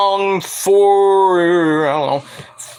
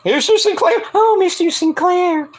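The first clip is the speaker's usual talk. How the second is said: mock swoon; 12 semitones higher than usual. Swooning over referee.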